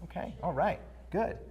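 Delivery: a listener humouring you, softly; mock affirming murmur. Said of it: "nod in affirmation and go".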